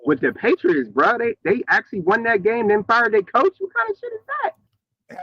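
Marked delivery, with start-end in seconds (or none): 3.6-5.2 put-on voice. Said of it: "Who's that sound like?".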